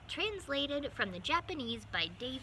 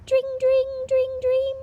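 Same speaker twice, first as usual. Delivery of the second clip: much higher, louder sing-song ding of a bell; bicycle bell; 16 dB louder, much slower than usual. Acted. Mice ringing bicycle bells on the way, sung bell sound. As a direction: ring it out like a little bike bell.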